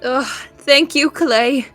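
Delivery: ordinary delivery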